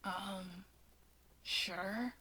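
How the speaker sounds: barely agree, awkwardly; hesitant mumble. Acted. Shigaraki answering Natsuo, shy and hesitant.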